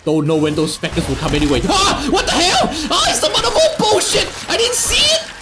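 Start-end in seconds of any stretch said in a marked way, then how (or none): 1.6-5.3 shrieky, very high scared rant; high, stretched-out cries of real fright; panicked screaming. Real, not acted. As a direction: scream and curse at a jump scare. Jump scare in game.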